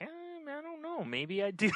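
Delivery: a squeaky high hedge, half joking; mock unsure self-quote. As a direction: play it up as if second-guessing yourself.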